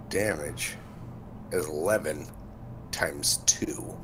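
whispered aside; whispering. Real, not acted. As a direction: breathe it out softly. Muttering damage under breath.

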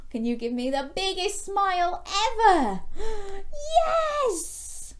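high, building to a loud squeal; hammed-up delight with a big gasp; gleeful excitement. Storyteller asking children for smiles, high gleeful excitement.

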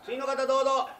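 high, loud summons; calling out. Acted. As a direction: call the next person in from outside. Calling in the next interviewee. (次の人を大声で呼び込む)

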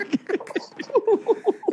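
high-pitched cackling burst of laughter. Real, not acted. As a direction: crack up helplessly in a high giggle. Cracks up at the idea.

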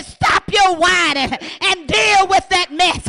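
slow, loud, firm command; God's stern rebuke. Retold or imitated. God rebuking the scared young preacher, slow stern shout. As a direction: lay it down like God scolding. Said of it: "God said".

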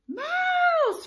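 very high, loud shriek; piercing storybook scream of alarm; frightened cry. A narrator's reading. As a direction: shriek it like a terrified elephant. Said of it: "cried the elephant".

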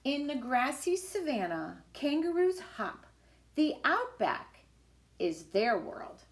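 lively, sing-song picture book reading; reading to children. Acted. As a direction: read it like a storybook for little kids. Reads picture book aloud.

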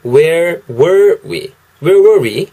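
teacher repeating the phrase; English demo again. Shown as a demonstration. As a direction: model "Where were we?" clearly, stress on "were". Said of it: "다시 한번 해보면은".

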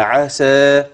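عسى with the aa after seen drawn out slowly; lengthened final vowel. Shown as a demonstration. Teacher shows which letter is lengthened, drawn-out vowel. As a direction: hold the long alif ending of عسى. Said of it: "فين الحرف الممدود؟ السين".

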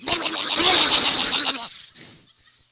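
loud, high garbage disposal racket; long, sputtering imitated machine noise; garbage disposal imitation. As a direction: roar like a grinding garbage disposal. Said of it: "It's the garbage disposal".

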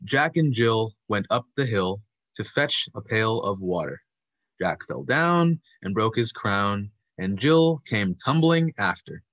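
slow, each word punched; emphatic demo recitation. Shown as a demonstration. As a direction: recite it slowly, hitting every word. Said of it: "I'll go first and I'll give an example".

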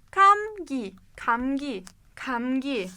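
Kamgi with the first sound close to K, not G. Shown as a demonstration. Teacher models Kamgi for the student. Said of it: "K'ye yakın okunuyor".

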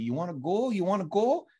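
imitating the enforcer goading the rookie, Canadian accent. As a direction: goad him playfully in a Canadian accent. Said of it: "I noticed the Canadian accent a lot more".

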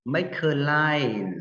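slow linked model. Shown as a demonstration. Teacher modeling linked phrase slowly for pupils. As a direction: make a line very slow with the words run together. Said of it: "nối âm là".

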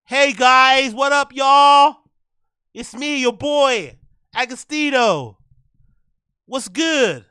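hammed-up American hype greeting, loud and high; bad American accent. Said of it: "That's not a good accent".

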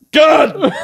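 high, loud cry of frustration; hammed-up outburst; mock exasperated dad. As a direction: imitate a fed-up father cursing. Exasperated dad in dark shower.

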